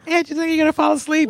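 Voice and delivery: high, quick mimicked question; imitating the co-host. Quoting the co-host's question back, high imitation. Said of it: "You said".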